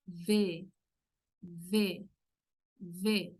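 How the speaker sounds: V held with teeth on lip, buzzing; Portuguese v. Shown as a demonstration. Teacher demonstrating the Portuguese v sound. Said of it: "los dientes de arriba en el labio inferior".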